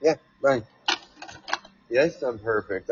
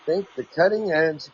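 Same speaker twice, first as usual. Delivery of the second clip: article read-aloud; 5 semitones higher than usual. Acted. Host reads a quote about Mars to listeners, raised reading voice.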